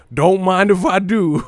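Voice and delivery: laughing quote. Retold or imitated. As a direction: cackle the line out with amusement.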